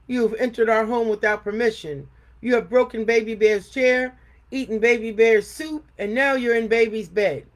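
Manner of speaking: loud scolding bear voice; stern Papa Bear. A narrator's reading. Papa Bear scolding the intruding girl, loud. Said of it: "said Papa Bear".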